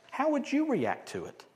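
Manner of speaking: ordinary talk, nothing marked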